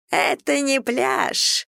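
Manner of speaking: high, drawn-out mimicking little voice; mocking squeak. A narrator's reading. Brad mimicking Ellie's complaint, mocking squeak. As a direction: squeak it slowly, making fun of her. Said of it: "издевательским голоском пропищал Брэд".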